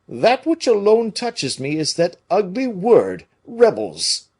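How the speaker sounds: offended musketeer; Porthos voice. A narrator's reading.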